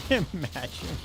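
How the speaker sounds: laughing hard, high and breathless; high laughter. Real, not acted. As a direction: crack up in helpless laughter. Laughing fit.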